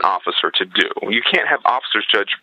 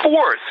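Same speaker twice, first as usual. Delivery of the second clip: Fourth! flung out high and sharp, much higher than before it; emphatic repeat. Real, not acted. Stressing to the host how many victims there were, emphatic high exclamation. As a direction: hammer the word Fourth in outrage.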